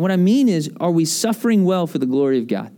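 ordinary talk

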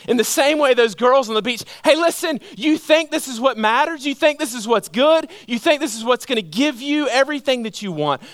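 high, urgent exhortation; impassioned preaching. Real, not acted. Preacher addressing the girls as Jesus.

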